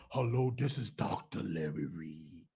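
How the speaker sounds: deep, hushed mock caller; Larry Reed on the phone. Imitating the doctor calling back, low and soft. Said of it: "get you back on the phone".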